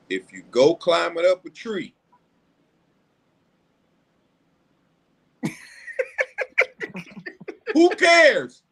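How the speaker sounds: mock wise-grandma setup with a long pause; dramatic pause. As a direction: hold the silence, then snap it. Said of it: "that dramatic pause".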